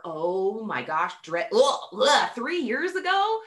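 ordinary talk, nothing marked

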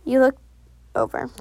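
ordinary delivery

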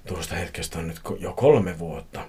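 ordinary delivery

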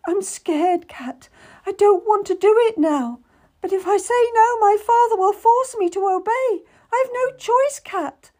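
high, frightened and near tears; a girl trapped by her father; tearful young lady. A narrator's reading. Crying lady tells Cat she has no choice, high and scared.

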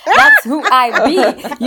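slow, loud laughing brag; hammed-up swagger, laughing; laughing boast. Real, not acted. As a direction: drag it out through laughter. Jokey boast while laughing.

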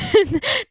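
high burst of laughter. Real, not acted. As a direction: laugh out loud, high and bright. Laughs at own confession.

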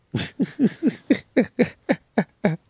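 high-pitched burst of laughter. Real, not acted. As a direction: crack up in a high squeal. Laughing at own joke.

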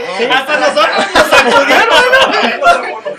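high, laughing through the words. Real, not acted. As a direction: giggle while you admit it. Host laughs at hard question.

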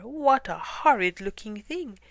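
appalled bear's outburst; gasped shock. A narrator's reading. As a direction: recoil aloud in alarm. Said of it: "gasped the bear".

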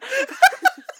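very high mock tiger roar squeal. Making a tiger roar for the tiger story. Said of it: "That's my tiger sound".

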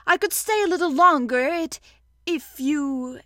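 hinting Pooh. Acted. Pooh hinting at Rabbit's larder, hopeful. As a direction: trail off hopefully. Pooh angling for more food.

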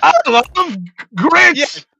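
very slow, high mock Boston drawl; Boston accent. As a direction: drag out the hammed-up Boston voice. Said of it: "Boston accent".

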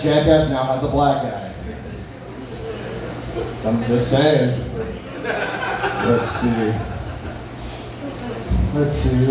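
usual speaking voice

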